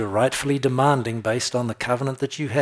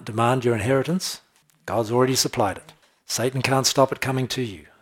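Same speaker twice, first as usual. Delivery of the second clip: usual speaking voice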